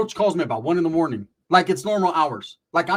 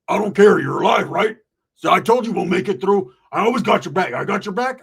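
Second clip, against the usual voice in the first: loud, brash mock bravado; hammed-up loud bravado; loud Roach voice; 4 semitones higher, 7 dB louder than usual. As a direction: boom it out like a cocky friend. Roach voiced in retold story.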